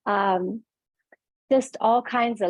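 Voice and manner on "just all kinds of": ordinary delivery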